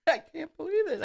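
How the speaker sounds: high, choked-up quote of the photographer; teary photographer. Retold or imitated. Guest imitates the moved photographer, high and overcome. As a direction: squeeze it out high, overwhelmed. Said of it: "the photographer's going".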